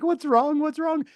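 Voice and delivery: high, anxious questioning voice of the wife; worried wife. Retold or imitated. Wife asks about injury.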